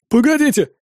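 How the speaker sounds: high-pitched anxious yelp; mildly panicked cry to wait; yelped plea. A narrator's reading. Captive twin begs the captors to wait, yelping. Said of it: "завопил тот".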